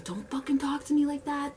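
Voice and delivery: high-pitched indignant snap; retold angry outburst. Worker cursing back at rude customer, high and indignant.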